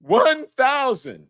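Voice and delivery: can you believe this, up high; host stunned by the number of bottles; incredulous. Real, not acted. Incredulous at baby oil.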